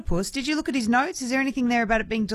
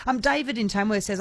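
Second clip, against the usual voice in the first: normal talking voice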